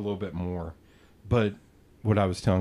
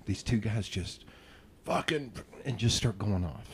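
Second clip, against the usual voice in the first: usual speaking voice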